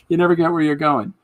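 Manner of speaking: ordinary talk, nothing marked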